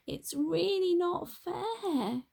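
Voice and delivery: high, slow wail of despair. A narrator's reading. Donkey wailing to herself about unfair treatment. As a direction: cry it out, really upset. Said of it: "she wailed in despair".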